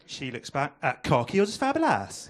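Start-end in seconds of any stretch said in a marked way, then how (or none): 1.0-2.3 hammed-up Welsh lover; lilting mock Welsh accent. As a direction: put on a Welsh accent for the reply. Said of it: "The best British accent there is".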